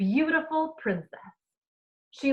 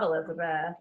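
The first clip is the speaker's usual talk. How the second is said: ordinary delivery, nothing marked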